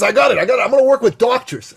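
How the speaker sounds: eager friend announcing his plan; eager Dan. Retold or imitated. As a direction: blurt the big idea like a proud kid.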